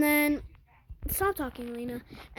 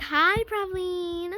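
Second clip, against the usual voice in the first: fake sweet greeting; 5 semitones higher, 3 dB louder, much slower than usual. Girl greets her sweetly.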